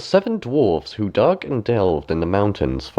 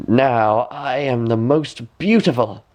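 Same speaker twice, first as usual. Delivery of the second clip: slow, gloating queen; 3 semitones higher, slower than usual. A narrator's reading. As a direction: savour the triumph. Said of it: "said the".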